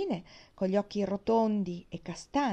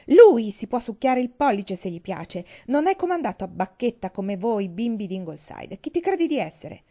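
angry; 8 dB louder than usual. Acted. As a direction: scold the guest with rising anger. Kurt angry at the guest. (rabbioso e forte)

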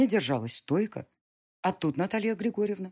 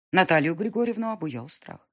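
plain reading voice

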